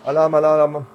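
playful high alarm call; alarm shout. Pretend. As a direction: call out the alarm, half joking. Barrel just ran empty.

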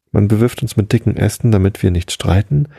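usual speaking voice

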